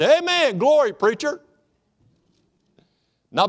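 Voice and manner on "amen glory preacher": loud, high call of amen and glory; enthusiastic amen. Pretend. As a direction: shout it out like an eager churchgoer. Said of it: "You should have said".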